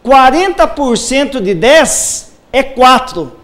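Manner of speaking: ordinary talk, nothing marked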